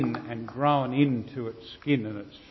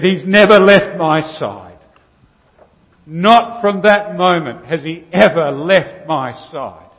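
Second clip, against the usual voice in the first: emphatic; 4 semitones higher, 11 dB louder than usual. Retold or imitated. Farmer's emotional retelling of the dog.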